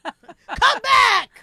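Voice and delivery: high, loud yell of victory; triumphant shout. Retold or imitated. She retells yelling at her fleeing ex, high triumphant shout. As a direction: bellow it like you just won the fight. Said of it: "I was like".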